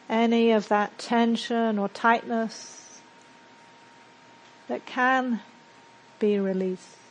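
measured meditation guide with long, settling pauses; guided meditation. Acted. Teacher guiding a group to settle into silence and breathe, guided meditation. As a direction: lead them into calm, leaving space between phrases.

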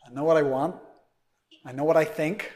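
confident New Yorker voice; New York accent. Shown as a demonstration. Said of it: "I'm trying to do a New York accent".

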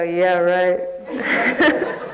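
cynical inner voice. Retold or imitated. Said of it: "and the voice would go".

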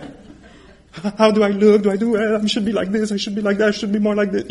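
high, loud, fretful self-doubt; nervous performer fretting aloud; anxious self-conscious lover. Retold or imitated.

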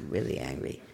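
low, soft, sullen muttering of anger; brooding angry meditator. Shown as a demonstration. Teacher demonstrating sitting with anger, low and sullen. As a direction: sink into a low, quiet stew of anger. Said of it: "sitting with".

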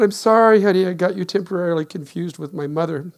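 loud, snide movie line; awful comment. Retold or imitated. Husband quotes a movie insult to wife, loud and snide. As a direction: fling the nasty line back at her. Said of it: "this awful comment in a movie".

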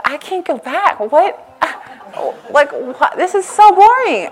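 exasperated. Retold or imitated. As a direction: throw up your hands at how boring it is. Retelling own exasperation after first day.

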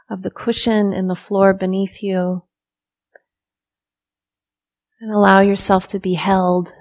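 soft, unhurried meditation guidance with long silences; guided meditation. Real, not acted. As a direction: ease listeners into stillness, softly, leaving room to breathe.

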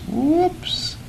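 high, soft little "whoops"; playful high-pitched oops; mock surprise. Acts out getting caught by sensations.

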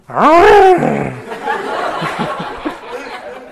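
high, loud trumpeting imitation of an elephant; elephant trumpet blast. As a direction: blare it out like a mighty elephant. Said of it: "let out a mighty blast, a trumpet".

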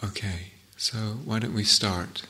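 hushed whisper to the room. Real, not acted. Teacher to meditators starting the sitting, whispered. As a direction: drop to a whisper to begin.

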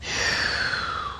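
hushed, airy exhale; breathy sigh. Shown as a demonstration. Teacher breathing with the moving class, hushed airy sigh. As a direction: blow the breath out softly. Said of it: "And quetching and breathing".

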